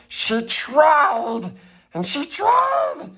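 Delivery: the train struggling up the hill; straining. Acted. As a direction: push harder each time.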